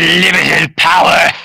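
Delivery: slow far-off emperor rasp; mock Palpatine unlimited power. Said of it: "in the Emperor's voice".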